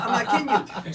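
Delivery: usual speaking voice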